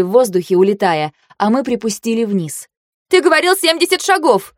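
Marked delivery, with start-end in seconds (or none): 3.1-4.5 high, indignant protest; shouted accusation. A narrator's reading. Heroine blaming Тир for the short throw, indignant shout. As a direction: yell the complaint mid-run.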